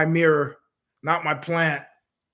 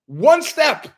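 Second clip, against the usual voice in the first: much higher and louder, disbelieving; incredulous outburst; 11 semitones higher, 6 dB louder than usual. Real, not acted. Scoffing at developer's seventeen-thousand-euro bill, incredulous higher yelp. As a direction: spit it out in disbelief.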